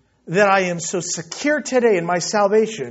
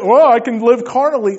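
raised, louder imitation of an excuse; mock permissiveness; 4 semitones higher, 8 dB louder than usual. Preacher mimics a believer excusing carnal living, mock-carefree. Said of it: "I'm not gonna".

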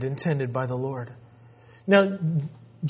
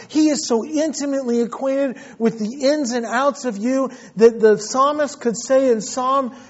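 high, fervent preaching voice; 7 semitones higher, 6 dB louder than usual. Acted. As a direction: lift the church with conviction. Preaching at full force.

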